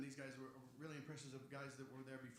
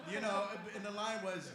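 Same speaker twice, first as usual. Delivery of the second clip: ordinary talk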